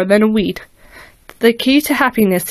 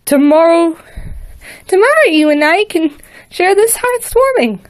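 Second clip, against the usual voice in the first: hopeful filly; 6 semitones higher than usual. A narrator's reading. Filly eagerly offers Vader a shared holiday, higher. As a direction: promise it eagerly like a child.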